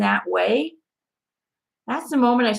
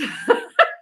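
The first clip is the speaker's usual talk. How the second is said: high laugh. Real, not acted. Laughs after mock surprise.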